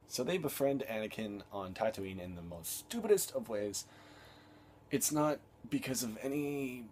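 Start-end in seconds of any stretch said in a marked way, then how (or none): none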